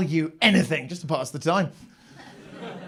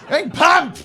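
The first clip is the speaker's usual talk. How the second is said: high, loud yell, dragged out slowly; hammed-up frantic shout from the handcar; shouted order; 7 semitones higher, 7 dB louder, much slower than usual. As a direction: bellow at the lazy crew. Railroad handcar worker yelling at others.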